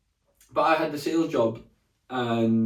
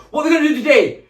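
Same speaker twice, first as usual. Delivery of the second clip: big rally-leader yell, much higher and louder; hyped-up shout; 13 semitones higher, 10 dB louder than usual. Retold or imitated. Sales boss firing up the office, loud shouted chant. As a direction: pump up the room like a sales boss. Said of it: "do a big fucking speech".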